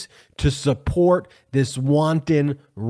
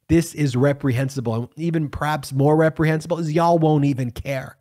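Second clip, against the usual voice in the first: usual speaking voice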